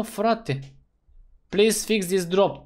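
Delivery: ordinary talk, nothing marked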